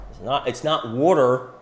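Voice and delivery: ordinary talk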